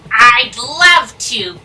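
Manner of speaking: normal talking voice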